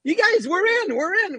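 high, giddy retold announcement; excited triumph. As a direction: imitate a friend thrilled to have got in. Acting out friend's triumphant return.

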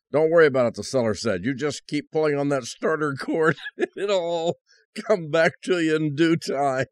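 lifted, lighter storyteller voice; upbeat joke-telling voice. Acted. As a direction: tell the joke with a grin.

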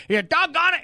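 a riled-up Tennessee caller cursing mildly; Southern caller, fed up. Retold or imitated. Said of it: "he used some choice".

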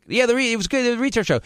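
high-pitched mocking impression of a woman; put-on high voice, casually tactless; imitated barista. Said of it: "she said it like".

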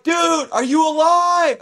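high, slow shout down the slope. Retold or imitated. Rescuer shouts down to the snowboarder in the wreck, high slow shout. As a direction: yell to be heard far below.

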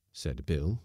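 plain reading voice

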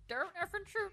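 high squawky parrot impression. Shown as a demonstration. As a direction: squeak it like a parrot. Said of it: "Would it be like".

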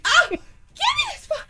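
high-pitched mock struggle; mom fighting herself. Mom wrestling her alter ego for the phone, high acted cry. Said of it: "And then she'd be like".

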